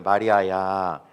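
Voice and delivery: low, drawn-out tender call; Jesus. Acted. Preacher models Jesus' call for the congregation to repeat, tender and low. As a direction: call the name slowly and gently, pitched low. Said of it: "정말 다정하고".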